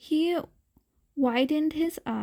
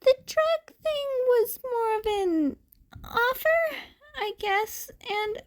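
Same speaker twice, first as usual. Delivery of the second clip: tearful laugh; 12 semitones higher than usual. A narrator's reading. Kid laughs off abuse.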